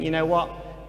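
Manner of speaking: normal talking voice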